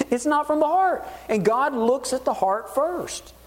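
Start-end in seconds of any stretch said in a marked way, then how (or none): 0.1-1.0 high pointed reply; God's answer. Retold or imitated. God answering the people about their gifts, high pointed reply. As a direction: answer them, pitched up and firm. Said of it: "And God says".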